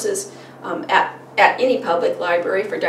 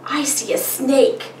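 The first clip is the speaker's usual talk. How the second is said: lightly voiced child, higher and slow; a hint of a little kid answering; child's answer; 5 semitones higher, slower than usual. Shown as a demonstration. Child answering the parent's picture question, lightly childlike. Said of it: "The child would say".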